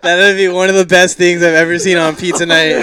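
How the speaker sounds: loud laughing while talking; can barely get it out. Real, not acted. Laughing at what happened.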